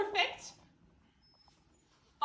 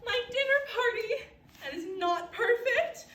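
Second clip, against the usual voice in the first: outraged cry; 7 semitones higher, 5 dB louder than usual. Acted. Mother outraged at apocalypse.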